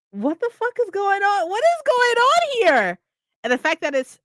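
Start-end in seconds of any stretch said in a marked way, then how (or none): 0.1-3.0 much higher, pitch climbing into a squeal of confusion; shocked disbelief. Retold or imitated. Reader intrigued by the weird timeline, squealing with shock. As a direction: let the bafflement spiral upward.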